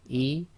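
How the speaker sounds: "E" with a clean glottal stop; Arabic hamza. Shown as a demonstration. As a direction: catch in the throat before the vowel. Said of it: "hamza again".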